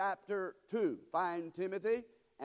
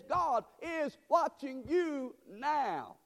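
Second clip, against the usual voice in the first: higher, slowing pulpit declaration; emphatic preaching; 6 semitones higher, much slower than usual. Real, not acted. Preacher warns the congregation God is watching, high and slow. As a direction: build to a slow, raised proclamation.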